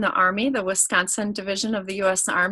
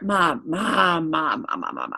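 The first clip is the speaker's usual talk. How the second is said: put-on pestering teen; 4 semitones lower than usual. Son nagging mom, retold.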